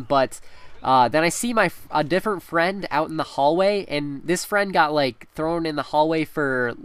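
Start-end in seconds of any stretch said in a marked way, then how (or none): none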